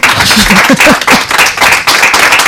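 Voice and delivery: breathy burst of laughter. Real, not acted. Host cracking up at the guest's awkward pause, breathy laughter. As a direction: crack up for a couple of seconds.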